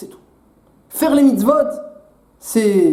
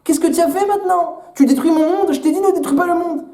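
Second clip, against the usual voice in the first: God scolding Adam; 6 semitones higher than usual. Acted. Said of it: "Akadosh Baruch Hu vient voir Adam Arishon et dit".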